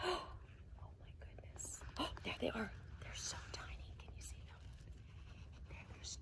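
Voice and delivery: gasping hushed whisper; excited whisper. Acted. Pointing out tiny mice to kids, excited whisper. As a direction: whisper in delighted wonder.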